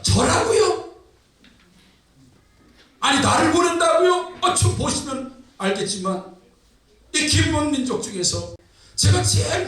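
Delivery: high-pitched, flustered Gideon excuses, acted out; backing down. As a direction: wriggle out in comic disbelief. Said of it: "꼬리를 쫙 갑자기 내리면서". (높은 음조로 꼬리 내리며 발뺌하는 연기)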